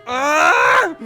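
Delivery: loud, high-pitched exasperated yell; frustrated scream. Acted. Natsuki fed up with Yuri's jab, frustrated scream. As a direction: scream it out at full force.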